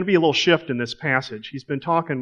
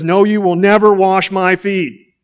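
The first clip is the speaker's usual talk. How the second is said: loud, higher flat no; emphatic refusal; 5 semitones higher, 9 dB louder than usual. Acted. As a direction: refuse outright, hitting never. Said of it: "And he's emphatic".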